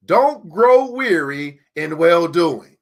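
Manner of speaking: very slow, stress on grow; repeated slowly so it sinks in; slow emphatic repeat. Shown as a demonstration. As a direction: let each word land slowly. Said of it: "Let me say that again".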